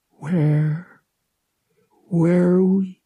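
put-on high, halting sick boy's voice; falter, pause, then try the question again; weak and dazed. A narrator's reading. Feverish boy in the Wookiee's arms, weak and dazed.

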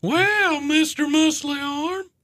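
high put-on cartoon teen voice; squeaky dopey teen; Chris Griffin. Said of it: "from Family Guy".

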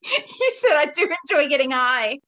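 laughing as you quote it back; high, loud, laughing tease; amused teasing. Real, not acted. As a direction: crack up while repeating her slip. Teasing co-host about slip, laughing.

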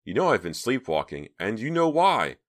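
mildly overwrought outburst; losing control. A narrator's reading. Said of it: "feeling herself start to lose control".

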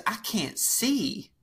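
a drawn-out helpless plea from the driver's seat; near-blind grandma. Retold or imitated. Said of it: "and she goes".

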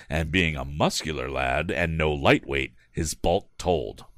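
ordinary delivery

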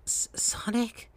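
a frail, failing whisper; feeble whisper. Acted. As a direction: breathe his name out like she's barely alive. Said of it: "in a voice like a person who was living poorly".

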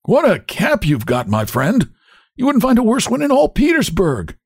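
high, jeering hunchback; mock the driver in a cracked, high voice; bossy, cracked-voiced taunting. A narrator's reading. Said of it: "in his cracked voice".

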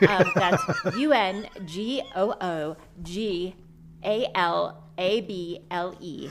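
spelled out letter by letter; spelling it out. Shown as a demonstration. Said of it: "Can you spell that?".

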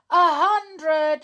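high, loud call; shouting. A narrator's reading. Tom shouts the final count to the hiders. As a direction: ring it out across the garden. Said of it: "shouted Tom".

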